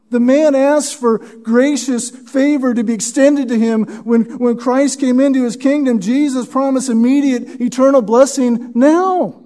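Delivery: ordinary delivery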